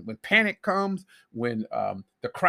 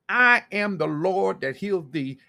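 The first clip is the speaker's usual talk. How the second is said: each word laid down slowly and firmly, a touch louder; slow emphatic declaration; 4 dB louder, slower than usual. Acted. Preacher to congregation, voicing God's promise in a slow, weighty declaration. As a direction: proclaim it as God's own promise.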